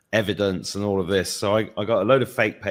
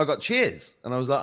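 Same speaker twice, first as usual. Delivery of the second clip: ordinary talk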